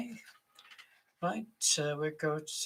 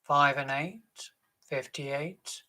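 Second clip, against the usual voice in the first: loud, drawn-out bingo calls; bingo caller; 9 dB louder, slower than usual. Acted. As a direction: call the numbers across the hall. Bingo caller calling numbers.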